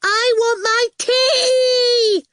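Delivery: put-on high, slow, loud child's wail; piercing yell. A narrator's reading. Little sister yells for her tea, piercing and drawn out. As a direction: scream it long and piercing. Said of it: "Awful yelled, in the piercing way".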